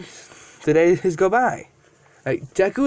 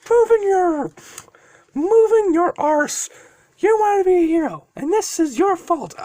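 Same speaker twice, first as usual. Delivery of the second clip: very high, pushed dragon retort; exasperated dragon; 13 semitones higher, 3 dB louder than usual. Acted. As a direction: scold the kid in a high, strained character voice. Dragon snapping back at Deku.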